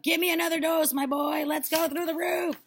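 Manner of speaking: high, loud, eager screech; screechy old woman. A narrator's reading. Grandma demanding another dose, screechy old voice. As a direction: screech your demand from above. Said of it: "the old screechy voice from up above".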